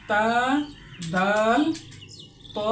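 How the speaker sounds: তা দাল ত with the tongue at the teeth roots; each letter modelled in turn; tajweed letter demo. Shown as a demonstration. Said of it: "উচ্চারিত হয়". (তা দাল ত স্পষ্ট উচ্চারণ)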